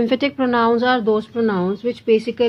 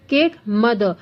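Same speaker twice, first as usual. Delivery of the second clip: ordinary delivery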